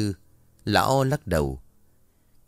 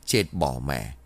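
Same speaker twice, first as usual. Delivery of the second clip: low resigned sigh of a curse; old healer sighing in dismay, much lower; 8 semitones lower, slower than usual. Acted. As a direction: let it sink out on a sigh. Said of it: "thở dài rồi nói".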